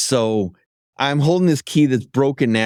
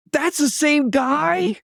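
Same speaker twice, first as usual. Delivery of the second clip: incredulous coworker; 13 semitones higher, slower than usual. Retold or imitated. Coworkers mock his blunder.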